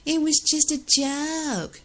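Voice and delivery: high, very slow whiny mock excuse; a whiny fake-innocent excuse; mocking innocence. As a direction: pretend innocence, sing-song. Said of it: "And you knew what you're".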